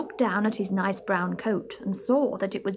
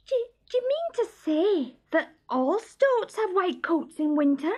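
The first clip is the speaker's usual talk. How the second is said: astonished; 11 semitones higher than usual. Acted. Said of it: "asked Barney in astonishment".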